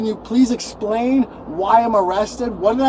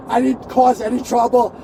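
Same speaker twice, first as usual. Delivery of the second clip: usual speaking voice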